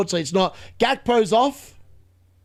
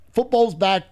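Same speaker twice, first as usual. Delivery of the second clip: usual speaking voice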